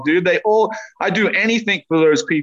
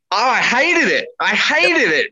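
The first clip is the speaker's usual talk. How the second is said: higher-pitched, exasperated complaint; retold frustration; 5 semitones higher than usual. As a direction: relive the angry frustration without shouting. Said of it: "because I was so freaking angry".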